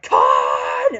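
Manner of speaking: loud, drawn-out mock movie yell; freaked-out yell. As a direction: bellow the name, imitating an enraged hero. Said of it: "freaks out and just goes".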